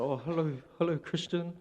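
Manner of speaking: lightly voiced old man mixing up names; grandpa greeting. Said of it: "I'd be like".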